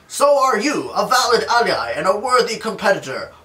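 high, loud mock heroic voice; hammed-up hero. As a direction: answer like a proud cartoon warrior. Acted hero reply.

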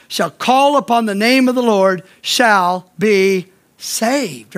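measured recitation of the promise; slow scripture reading. Shown as a demonstration. Preacher quotes Romans verse slowly.

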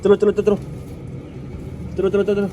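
loud high shouts driving birds out; shooing loudly. Real, not acted. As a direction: chase them out with shouts. (ਉੱਚੀ ਆਵਾਜ਼ 'ਚ ਭਜਾਉਣਾ)

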